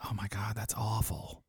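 a wife whispering shock at the TV news; hushed whisper. Retold or imitated. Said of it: "She was like".

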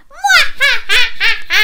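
high, loud villain cackle; piercingly high mwahaha; squealed evil laugh. A narrator's reading. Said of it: "squealed the evil pea".

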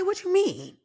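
high-pitched retold question; guarded disbelief. Client questioning the therapist, high-pitched and thrown. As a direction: ask it high, acting taken aback.

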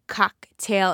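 cocktail split after a punched cock; stress on COCK. Real, not acted. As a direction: lean hard on the cock in cocktail. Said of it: "me emphasizing the word cock".

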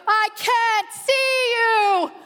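high, loud, slow dazed yell; shouting groomsman. Retold or imitated. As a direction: call out blindly from the stage. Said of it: "And he's going".

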